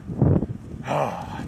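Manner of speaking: a quiet, deflated sigh; soft tired sigh. Real, not acted. Fed-up cyclist mid-ride, soft tired sigh. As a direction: sag and breathe out the frustration.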